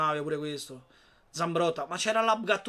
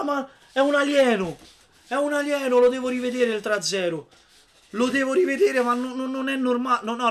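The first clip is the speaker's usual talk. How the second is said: amazed; 7 semitones higher, 6 dB louder than usual. Real, not acted. Still amazed by the goal.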